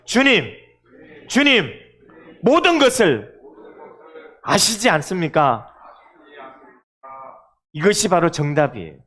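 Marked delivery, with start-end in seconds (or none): 0.1-5.7 confession of faith. Shown as a demonstration. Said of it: "우리 믿음으로 고백해 볼까요".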